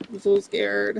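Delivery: high, hammed-up frightened voice; mock scared. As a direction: mimic a cowering heckler.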